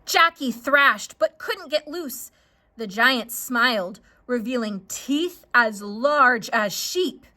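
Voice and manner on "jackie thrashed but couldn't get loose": ordinary delivery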